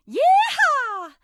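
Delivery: high, loud cowgirl yeehaw; a Southern belle whooping over a new friend. Acted. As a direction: holler it like a rodeo cheer.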